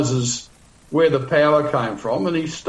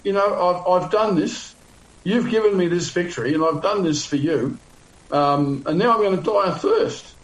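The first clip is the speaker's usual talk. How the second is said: higher-pitched mock complaint to God; acted accusing prayer, pitched up; 4 semitones higher than usual. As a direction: plead your case in a raised, aggrieved pitch. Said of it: "So now he's accusing God and saying".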